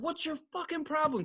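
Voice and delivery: high-pitched, soft imitation of her; mock yell. Stripper to her boyfriend, a mock yell held down. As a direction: imitate a yell without the volume. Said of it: "she just yells out".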